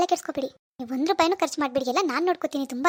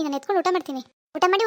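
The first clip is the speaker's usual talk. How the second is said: usual speaking voice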